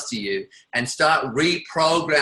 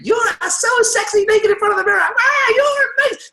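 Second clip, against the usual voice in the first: much higher, louder, shouted over-the-top praise; hyped inner cheerleader; 6 dB louder than usual. Pretend. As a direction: scream encouragement at yourself like a wild fan. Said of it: "a mind that was like".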